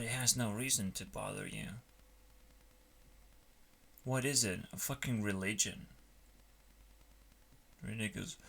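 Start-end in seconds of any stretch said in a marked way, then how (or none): none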